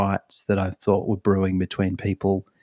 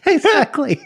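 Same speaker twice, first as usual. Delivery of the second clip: excited agreement; 5 dB louder than usual. Real, not acted. Laughing agreement.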